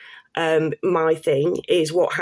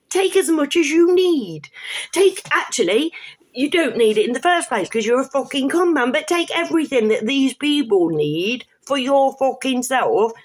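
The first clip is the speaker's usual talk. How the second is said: higher, put-on voice goading thieves; mocking invitation to criminals; 5 semitones higher than usual. The ticket scheme mockingly telling criminals to help themselves. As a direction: voice the scheme itself welcoming crooks. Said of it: "It's saying to the criminals".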